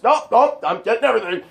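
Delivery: high, loud, hurried-sounding mimicry; acted urgency. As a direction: blurt it as the action kicks off. Acting out filming a physical fight.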